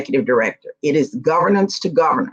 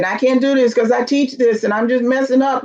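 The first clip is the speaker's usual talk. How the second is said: high, mock-crying voice; imitated tearful wail; 7 semitones higher than usual. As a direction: act out a tearful protest, pitched well up. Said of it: "First of all, stop crying".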